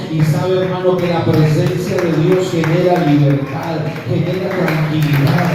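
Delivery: loud, driving sermon cadence; preaching at full force. Real, not acted. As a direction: proclaim God's mercy with full fervour. (predicación con toda la fuerza)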